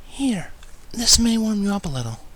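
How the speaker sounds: gentle Fluttershy. Acted. Fluttershy offering to warm the narrator, high and gentle. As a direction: offer warmth shyly.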